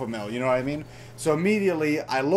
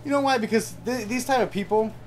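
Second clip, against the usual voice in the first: sudden high burst; 6 semitones higher than usual. Real, not acted.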